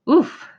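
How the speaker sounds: sharp winded grunt, high and loud; startled oof. Acted. Sleeping cabbie jolted awake with a loud oof. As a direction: let a weight knock the air out of you. Something lands on sleeping Edgar.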